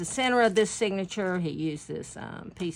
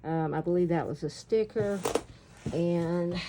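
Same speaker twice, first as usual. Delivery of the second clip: ordinary talk, nothing marked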